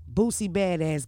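ordinary talk, nothing marked

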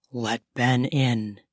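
low, slow husband voice; serious command. Acted. Husband orders his wife to open up, dead serious. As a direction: give a deep, deliberate order. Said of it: "in a tone that left me with no question of how serious he was".

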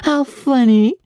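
high, slow, amused wife's voice; drawn out with delighted amusement; wife, amused, teasing her husband. A narrator's reading. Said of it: "appreciative laugh".

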